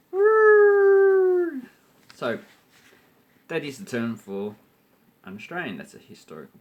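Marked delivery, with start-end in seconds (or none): 0.1-1.6 very high loud whoop; mock whoop. Parodying Howard with a high, loud whoop. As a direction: whoop like a giddy politician.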